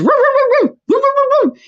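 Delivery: high yappy barks; dog barking. Retold or imitated. Little dogs running at her, high yappy barking. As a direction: yap like a pack of little dogs. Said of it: "and they just".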